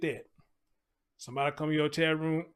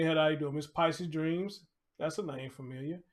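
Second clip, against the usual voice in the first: usual speaking voice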